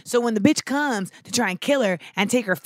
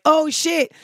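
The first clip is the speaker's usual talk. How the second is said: higher, stunned realization; a higher shocked mock voice; shocked slaver; 8 semitones higher, 3 dB louder than usual. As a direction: gasp it like you just got caught. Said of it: "he's like".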